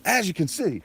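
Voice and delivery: high, loud bad Aussie accent; Crocodile Hunter. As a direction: ham up a terrible Australian presenter. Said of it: "that was a terrible Aussie accent".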